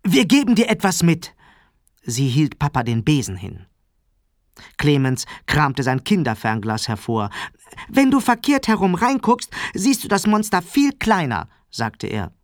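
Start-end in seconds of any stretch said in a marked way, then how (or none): 0.0-1.4 put-on high, bright-idea child voice; calling out eagerly. A narrator's reading. Sarah jumps up with an idea, high eager call. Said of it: "sprang auf und rief". 7.9-11.5 put-on high-pitched little boy voice; eager child. A narrator's reading. Clemens offers Papa his binoculars, high and eager.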